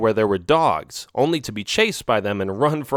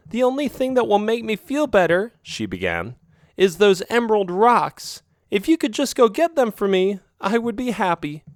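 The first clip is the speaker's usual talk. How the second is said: raised, pleading cat voice; higher-voiced girlfriend cat; 10 semitones higher than usual. Girlfriend cat pleading about her shabby alley home, higher voice. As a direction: sell the wish sweetly, voice up high. Isabel demands the rocks.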